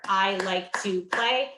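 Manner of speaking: each word said slowly and apart; clapped words. Shown as a demonstration. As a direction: space out every word for clapping. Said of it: "Clap out each word".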